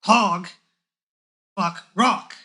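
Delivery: loud, dim orc growl. Acted. Orc to old man, loud and dim. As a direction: bark it like a dumb orc. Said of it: "the orc says".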